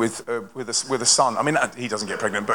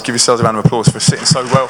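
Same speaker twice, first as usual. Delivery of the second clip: louder, rallying the room to clap; calling out to the crowd; 8 dB louder than usual. Real, not acted. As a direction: lift the voice over the hall for applause.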